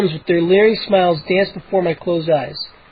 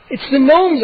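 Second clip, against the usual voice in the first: higher, urgent shout; 7 semitones higher than usual. A narrator's reading. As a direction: blurt it out. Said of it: "I shouted".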